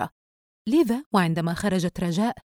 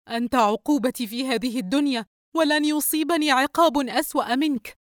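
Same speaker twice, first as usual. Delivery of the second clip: higher-pitched bitter outburst; 7 semitones higher than usual. A narrator's reading. Wife telling husband he is her punishment, bitter. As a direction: lash out at your husband.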